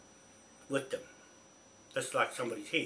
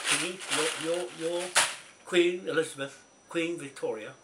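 normal talking voice

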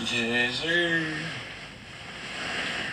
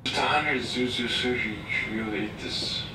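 groggy sleep-talk mumble; 2 semitones higher, 3 dB quieter than usual. Real, not acted. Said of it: "This is insane".

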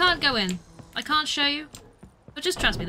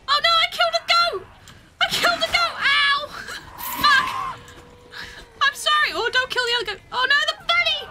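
horrified yell; 9 semitones higher than usual. Real, not acted. Streamer shouting after killing a goat in game, high horrified. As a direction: shriek in real dismay at the game.

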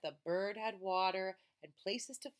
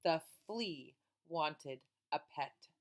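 usual speaking voice

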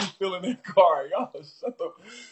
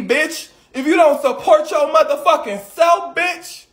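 higher, louder, building furious yell; furious shout; 5 semitones higher, 5 dB louder than usual. Real, not acted. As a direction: tear into him, getting angrier and louder. Host blows up at caller.

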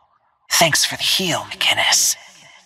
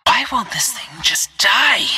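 higher, frustrated combat cry; fed-up, raised-pitch complaint; exasperated outburst; 6 semitones higher than usual. Acted. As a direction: vent frustration at an enemy that stays standing. Frustrated outburst during a fight.

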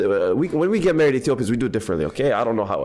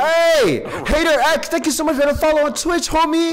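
high, loud hype thanks; excited shoutout; 11 semitones higher, 3 dB louder than usual. Real, not acted. Streamer thanking a new Twitch follower, loud and excited.

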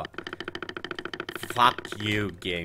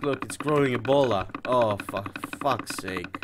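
ordinary delivery

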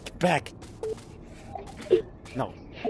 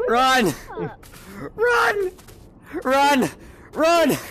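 high, raised-voice warning yells; urgent calling out; 6 semitones higher, much slower than usual. Real, not acted. Gamer urging his buddy to flee, shouting urgently. Player urges others to flee.

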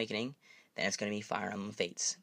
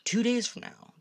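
excited; 13 semitones higher, 4 dB louder than usual. Real, not acted. Excited about game release.

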